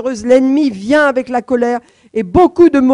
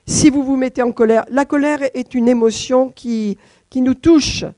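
ordinary talk, nothing marked

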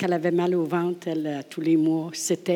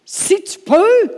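"Si tu peux" slow, much higher and louder, "peux" held; open-mouthed si tu peux; 13 semitones higher, 9 dB louder, much slower than usual. Shown as a demonstration. Jesus stunned by the father's doubt, high and loud. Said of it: "la bouche ouverte".

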